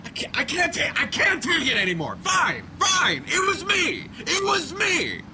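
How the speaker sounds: yelling confession. Acted. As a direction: yell it out in pain and defeat. Confessing after being tased.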